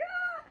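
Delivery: high mock scream; retold scream. Imitating friend clinging to car, high scream. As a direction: squeal like the panicked friend.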